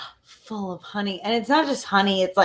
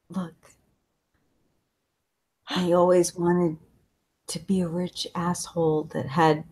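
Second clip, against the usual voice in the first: dying breath; 6 semitones lower than usual. Acted. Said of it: "In his dying breath, he says".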